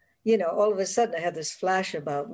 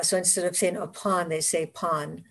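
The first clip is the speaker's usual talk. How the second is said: ordinary talk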